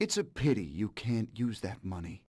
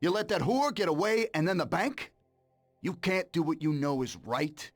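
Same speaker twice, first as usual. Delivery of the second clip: higher, louder reproach building up; angry accusation; 7 semitones higher, 3 dB louder than usual. Acted. Sam listing Tom's betrayals of the family, angry. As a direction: throw every failure in his face.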